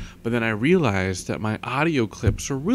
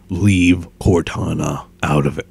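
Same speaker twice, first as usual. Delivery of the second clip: low, slow, forceful soldier voice; Master Chief; 3 semitones lower, 6 dB louder than usual. Shown as a demonstration. Vlogger attempting a game hero, low and forceful. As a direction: attempt a gruff armored hero. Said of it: "I can't do Master Chief".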